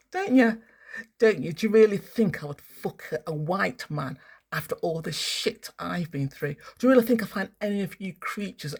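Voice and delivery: worked-up rant, loud and high; going off on one. Real, not acted. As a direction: launch into an indignant tirade. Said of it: "I'm going off on one".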